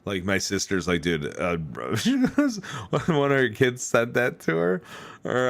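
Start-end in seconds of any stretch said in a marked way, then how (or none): 2.0-5.5 laughing. Real, not acted. Laughing retelling the sister.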